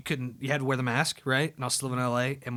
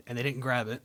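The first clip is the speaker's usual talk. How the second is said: normal talking voice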